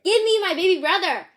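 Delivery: loud, high demand; girl yelling at gorilla mother; demanding shout. Acted.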